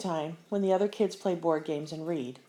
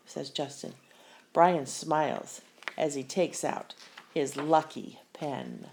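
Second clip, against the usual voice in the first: ordinary delivery, nothing marked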